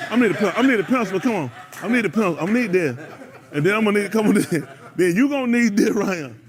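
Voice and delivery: loud, laughing mock begging; laughing student plea. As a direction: beg for a pencil while cracking up.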